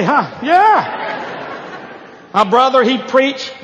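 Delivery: ordinary talk, nothing marked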